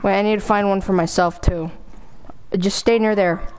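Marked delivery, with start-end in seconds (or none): none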